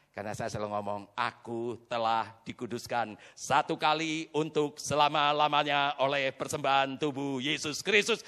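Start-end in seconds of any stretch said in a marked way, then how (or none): 1.2-8.2 slow, low-pitched confession; slow declaration of faith in a low voice; measured recitation. Retold or imitated. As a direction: recite it deliberately, phrase by phrase.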